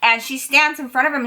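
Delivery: normal talking voice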